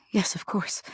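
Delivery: whisper. Acted.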